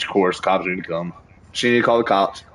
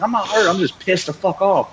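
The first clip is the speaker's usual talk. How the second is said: higher, heated insistence; pissed-off retort; 9 semitones higher than usual. Retold or imitated. Telling grandma on the phone, still angry. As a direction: snap back still fuming.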